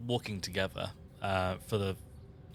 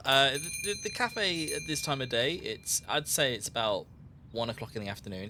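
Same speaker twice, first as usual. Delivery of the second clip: ordinary delivery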